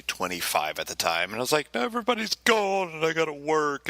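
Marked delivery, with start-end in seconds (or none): 1.7-3.9 high, put-upon whine; mock whine about being stuck at work; whiny self-quote. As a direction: complain like a sulky kid. Whining about working alone.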